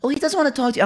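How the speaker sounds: high mimicking mother voice; mock mom. Son mimics mom relaying brother's refusal, high mocking voice. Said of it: "she's like".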